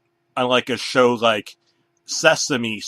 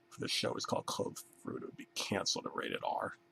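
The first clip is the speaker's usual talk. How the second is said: unvoiced quick whisper; whispered aside; 16 dB quieter, faster than usual. Real, not acted. Comic whispering a crude aside to the crowd. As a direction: hush it fast like a secret.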